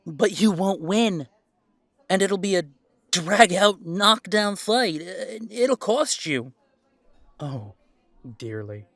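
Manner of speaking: slow, loud, menacing warning; standing up to his father, a loud, slow warning; loud threat. Acted. Threatening rivals with a fight.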